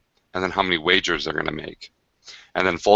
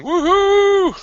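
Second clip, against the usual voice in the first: high whooping cheer; a loud falsetto woohoo; 6 dB louder than usual. Retold or imitated. Friend's message read out as a whoop. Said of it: "a message from Ryan Macklin going".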